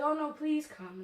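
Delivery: lightly voiced plea; pleading friend. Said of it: "then she was like".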